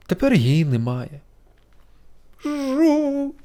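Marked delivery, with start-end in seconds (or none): 2.4-3.3 very high weeping beetle buzz; crying buzz. A narrator's reading. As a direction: buzz through tears. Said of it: "ще сильніше заплакав Жук". (жук плаче)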